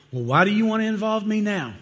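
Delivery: high-pitched put-upon prophet; mock indignant challenge. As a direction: act out an affronted, challenging question. Acts out prophet challenging the king.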